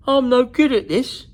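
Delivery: loud, high wail of frustration; young dragon crying out he can't do it; despairing cry. A narrator's reading. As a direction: cry it out in defeat. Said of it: "he cried".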